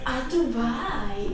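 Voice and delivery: slow, drawn-out parody voice; mocking imitation. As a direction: drag the words out mockingly. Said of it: "ti sembra che parlo così io?".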